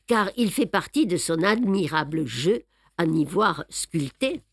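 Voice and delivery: ordinary delivery, nothing marked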